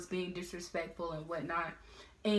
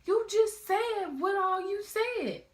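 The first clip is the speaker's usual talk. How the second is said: high, loud, frantic whine; mock panicked pleading; 14 semitones higher, 6 dB louder, slower than usual. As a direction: plead in a scared squeaky voice. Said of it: "I'm like".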